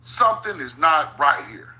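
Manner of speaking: ordinary talk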